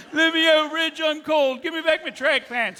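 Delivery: high-pitched, loud mock plea; pleading captive. [name] begs Reg to free her from the cage, mock pleading. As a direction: call out high as someone locked in.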